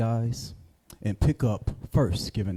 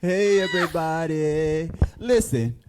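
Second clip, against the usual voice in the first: radio DJ voice; 4 semitones higher, 4 dB louder, slower than usual. Pretend. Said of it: "you sound like you could work at a radio station".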